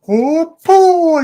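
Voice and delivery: big high, loud showman's exclamation; mock amazement. Retelling a magic act, mock amazement. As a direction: blow it up into a huge wow.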